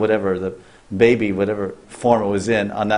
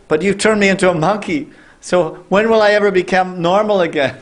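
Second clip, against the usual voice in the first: high, pleading, no real tears; pleading maidservant; 7 semitones higher than usual. Acted. Cursed maidservant begging the sage to forgive her, high pleading. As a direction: beg forgiveness in a raised, imploring voice. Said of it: "So she was crying".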